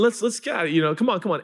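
disciples shooing parents. Acted. Acting disciples herding families away.

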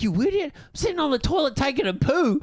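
annoyed sister snapping back. Retold or imitated. Sister explaining why she was curt, high sharp retort. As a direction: put her brother in his place. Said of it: "She's like".

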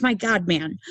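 ordinary delivery

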